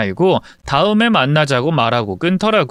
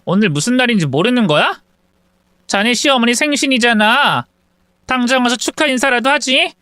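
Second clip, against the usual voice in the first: higher, quick sharp scolding over the phone; scolding daughter; 8 semitones higher than usual. Acted. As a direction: tell off the sister-in-law fast. Daughter scolds sister-in-law by phone. (전화로 올케를 나무라는 딸, 높고 빠르게)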